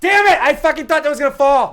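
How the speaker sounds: high, loud frustrated yell; frustrated shout. Real, not acted. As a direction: blurt out in anger over the pick. Pick stolen, outburst.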